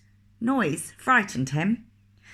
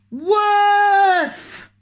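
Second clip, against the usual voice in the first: big high shouted dog bark; loud woof; 10 dB louder than usual. Acted. Reader barking like a Loudland dog, loud and high. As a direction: bark it out as loud as you can. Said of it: "They went".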